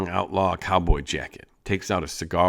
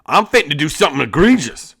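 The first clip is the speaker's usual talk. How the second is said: itching to fight; 8 semitones higher, 9 dB louder than usual. Acted. Gang member ready to fight.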